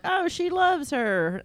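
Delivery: high, slow, drawn-out coo; mock-sweet and high-pitched; imitated gushing. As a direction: coo it as if over a puppy. Campers gushing over her and puppy.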